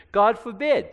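ordinary delivery

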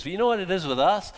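ordinary talk

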